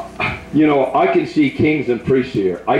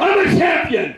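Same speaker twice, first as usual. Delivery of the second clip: high, shouted renunciation, much higher; shouted prayer. Real, not acted. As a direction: cast it out at the top of the voice.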